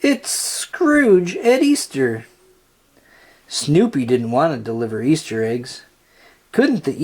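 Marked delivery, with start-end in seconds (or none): 0.0-2.3 slow, high, disdainful; scornful. A narrator's reading. Sally mocking the grumpy beagle, slow and scornful. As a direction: drag it out in mock disgust. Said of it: "Sally said".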